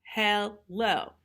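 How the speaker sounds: usual speaking voice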